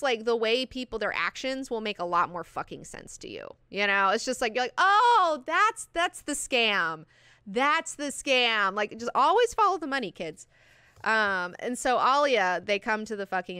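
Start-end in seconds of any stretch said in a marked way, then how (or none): 4.8-8.7 loud "oh", then slow, emphatic verdict; drawn out, as if it all clicks; acted realization. Voicing the moment of realization.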